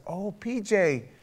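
high-pitched, drawn-out friendly hello; reenacted upbeat phone greeting; excited greeting. Acts out cheerful greeting of caller.

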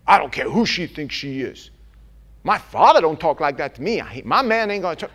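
loud, fast indignant rant; mocking a prideful member, loud and quick; proud defiant churchgoer. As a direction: bristle like someone nobody can correct. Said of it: "here's what I get".

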